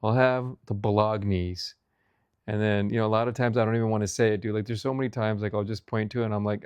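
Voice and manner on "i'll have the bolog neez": slow "bolognese" as buh-LOG-neez; Americanized pronunciation. Shown as a demonstration. Diner ordering stubbornly, "bolognese" said buh-LOG-neez. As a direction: order it slowly, "bolognese" with a hard g. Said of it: "I'm gonna say bolognese".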